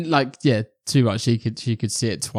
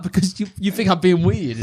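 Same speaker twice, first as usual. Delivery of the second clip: laughing defence; 7 semitones higher than usual. Retold or imitated. Self-quote laughing.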